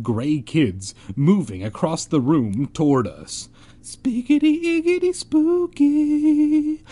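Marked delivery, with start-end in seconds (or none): 3.8-6.8 very high, slow, eerie wail; spooky ghost voice. Pretend. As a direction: drag it out like a cartoon ghost. Spooky silly interjection.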